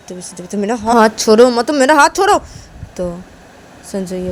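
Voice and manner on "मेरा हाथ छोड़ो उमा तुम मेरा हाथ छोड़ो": loud, rising demand; heated protest climbing in pitch; angry demand. Acted. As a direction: order them to let go, building louder. Sanju demands she release his hand.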